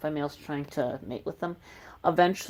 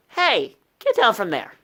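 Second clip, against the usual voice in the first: sharp, loud, higher scolding; scolding shout; 6 semitones higher, 7 dB louder than usual. Real, not acted. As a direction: tell the animal off sharply. Scolding a pet toad.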